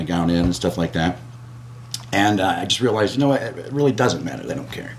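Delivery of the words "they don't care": whispered, resigned; muttered aside. Real, not acted. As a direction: say it under your breath.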